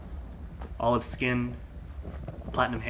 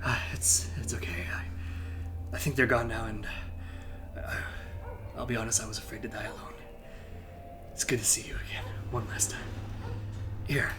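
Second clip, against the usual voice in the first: faint whispered farewell, fading breath; softer dying knight whispering to his student; 7 dB quieter than usual. Acted. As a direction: spend your last breath on these words. Master dies in student's arms.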